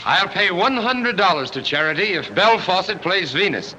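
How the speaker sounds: high, slow, carrying call. Acted. Bidder offers a hundred to charity, calling out. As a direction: call the bid out across the room. Man calls out auction bid.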